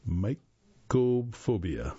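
mycophobia drawn out syllable by syllable; sounded out slowly. Real, not acted. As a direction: stretch out "mycophobia" slowly and deliberately. Introduces an unfamiliar term.

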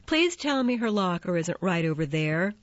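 usual speaking voice

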